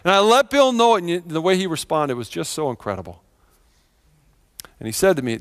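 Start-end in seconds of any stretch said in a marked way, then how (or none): none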